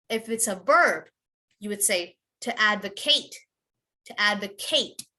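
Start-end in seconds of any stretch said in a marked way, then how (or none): 2.4-5.1 advocate as AD-vuh-KATE; full ATE ending. Shown as a demonstration. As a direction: give advocate a long A at the end. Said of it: "You would pronounce the A T E".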